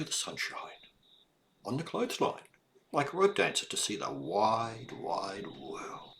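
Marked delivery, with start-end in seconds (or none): 5.0-6.1 hushed whisper. A narrator's reading. Storyteller hushes wide world.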